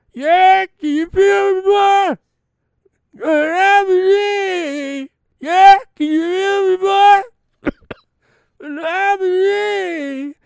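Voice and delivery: very high, strained falsetto wail, slow and drawn out; hammed-up high crying croon, yelling boy. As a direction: caricature a broken singing voice, squealing and sobbing. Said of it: "That's how he sounds now".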